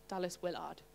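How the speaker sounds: quiet, a bit northern on WILLARD; hushed, tentative northern-sounding aside; slightly northern accent. Shown as a demonstration. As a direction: softly try WILLARD with a full northern "ard" ending. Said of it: "that sounds a bit northern".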